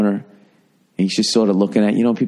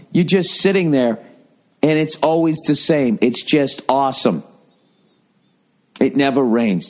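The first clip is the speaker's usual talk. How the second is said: ordinary delivery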